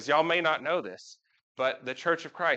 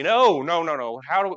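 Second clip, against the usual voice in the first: normal talking voice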